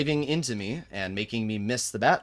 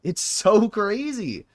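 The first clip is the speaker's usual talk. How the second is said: exasperated outburst; 8 semitones higher, 7 dB louder, much slower than usual. Real, not acted. Exasperated outburst at enemy tank.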